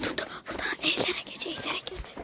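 hushed unvoiced whisper; whispering while hiding. Real, not acted. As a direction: whisper so nobody hears. Hiding from someone nearby.

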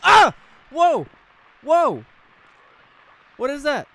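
screaming high and loud; terrified scream. Real, not acted. Gamer jumped by a corpse, screaming. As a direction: scream at the sudden scare. Jump scare in game.

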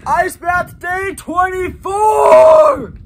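big shouted show opener; hype intro shout. Acted. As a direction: yell the intro, hyped.

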